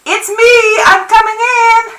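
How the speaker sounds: high, loud, ringing call; shouting through door. A narrator's reading. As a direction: shout for someone hard of hearing. Shouting through door to deaf grandmother.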